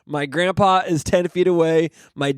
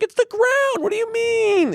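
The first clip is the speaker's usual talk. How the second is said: much higher, exasperated disbelief; husband's high incredulous retort to wife. Retold or imitated. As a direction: squeak it out in disbelief. Retold argument with wife.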